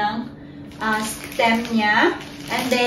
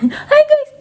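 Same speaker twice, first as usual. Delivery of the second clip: put-on very high, loud "Hi guys" in a baby voice; baby-talk greeting; 13 semitones higher, 6 dB louder than usual. Shown as a demonstration. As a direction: squeal it for the little one to copy. Coaching baby to greet viewers. (matinis at malakas na "Hi guys" para sa baby)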